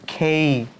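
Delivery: "খেই" with breathy kh and e-i glide; aspirated K. Shown as a demonstration. Teacher modelling letter K like খ, aspirated "খেই". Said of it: "খয়ের মতো pronounce করতে হবে".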